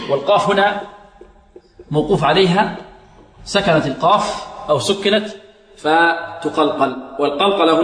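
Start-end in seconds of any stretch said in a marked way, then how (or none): none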